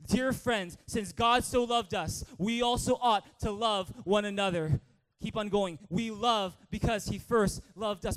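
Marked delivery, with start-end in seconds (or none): 0.1-4.8 loud scripture reading, every phrase driven out; loud, proactive reading. Shown as a demonstration. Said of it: "with a loud, out loud attitude". 5.9-8.2 loud, slow, emphatic scripture line; loud, proactive reading. Shown as a demonstration. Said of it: "with a loud, out loud attitude".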